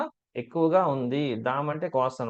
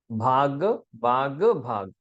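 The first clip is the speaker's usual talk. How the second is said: ordinary talk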